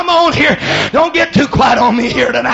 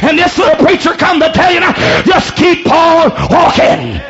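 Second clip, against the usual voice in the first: shouted exhortation building higher at the end; full-force preaching; 4 semitones higher than usual. Real, not acted. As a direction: drive it home, stretching the final phrase.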